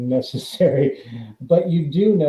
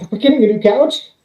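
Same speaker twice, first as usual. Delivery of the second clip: excited wife; 9 semitones higher, 7 dB louder than usual. Retold or imitated. Wife hears about the pay.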